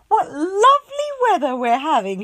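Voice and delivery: high, loud cheery remark; cheerful hostess. A narrator's reading. Mother voicing Miss Contrary praising the rain, cheerful. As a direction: delight in the rain. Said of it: "she said".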